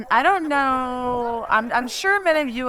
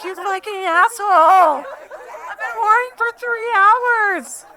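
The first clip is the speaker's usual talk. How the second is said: half-laughing, much higher mock rage at a friend; mock furious yell; 13 semitones higher, 6 dB louder than usual. Friend yelling at Gordon after hours of worry, laughing mock fury. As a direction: yell at him through laughter, hammed-up anger. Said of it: "And I was like".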